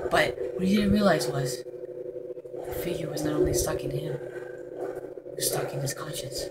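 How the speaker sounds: mock-dramatic horror narrator; a hushed creepy storyteller; story narration. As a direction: narrate it like a spooky trailer. Narrates his own game like a story.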